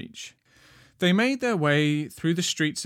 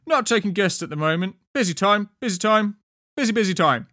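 high, loud officious guard; second guard; 6 semitones higher, 6 dB louder than usual. A narrator's reading. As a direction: brush visitors off loudly. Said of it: "the second guard replied".

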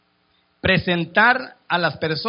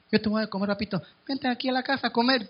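softer, an inviting woman's voice; coaxing woman; 4 semitones higher, 5 dB quieter, faster than usual. Acted. The woman invites the poor man home to eat, softly. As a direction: invite him in sweetly. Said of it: "la mujer, ah, es más".

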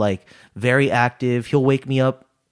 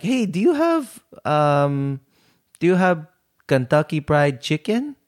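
high-pitched, badly imitated Lolo accent; bad grandpa accent; 4 semitones higher than usual. Grandson retells Lolo asking for fried chicken, bad grandpa accent. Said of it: "that was a bad accent".

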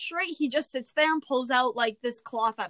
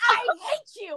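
mock bratty outburst; 10 semitones higher than usual. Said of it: "then she goes".

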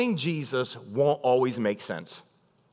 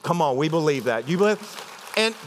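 ordinary talk, nothing marked

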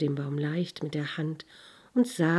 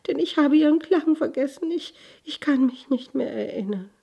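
put-on high, quick, sorrowful girl; a lost child's plea, pitched up; little girl voice; 8 semitones higher, 5 dB louder than usual. Girl's lament, high voice. (hohe Mädchenstimme)

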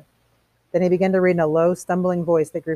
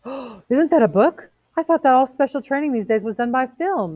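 higher voice, wide-eyed surprise; astonished woman; 9 semitones higher, 4 dB louder than usual. Acted. Missus Phelps spotting a forbidden book, high and astonished. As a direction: ask in high, innocent astonishment.

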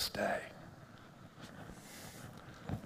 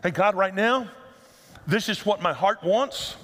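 an earnest, lively prayer; 4 semitones higher than usual. Shown as a demonstration. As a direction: pray it like honest everyday talk. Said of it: "if you prayed that prayer".

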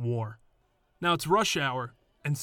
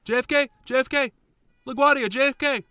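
much higher, loud hawking cab driver; yelling cabbie; 12 semitones higher, 8 dB louder than usual. Retold or imitated. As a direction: bark out the airports like a pushy cabbie. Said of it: "he starts yelling at them".

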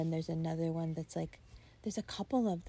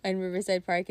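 ordinary talk

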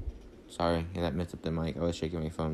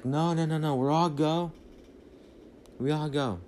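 higher, urgent mock protest; retold insistent refusal; 6 semitones higher than usual. Mom to pilot about her family, insistent refusal. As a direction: imitate someone firmly refusing to be split up.

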